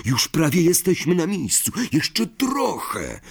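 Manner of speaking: high-pitched coaxing, slowing at the end; pleading. A narrator's reading. Ron coaxes the dying car onward, high pleading. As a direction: beg with rising urgency, drawing out the last words. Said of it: "błagalnym tonem".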